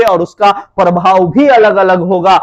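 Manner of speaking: normal talking voice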